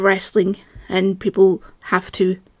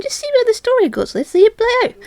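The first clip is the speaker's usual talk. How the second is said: much higher, breezy shrugging voice; carefree voice; 13 semitones higher than usual. Retold or imitated. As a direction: play the calm dismissive fan. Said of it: "I'm the kind of person that goes".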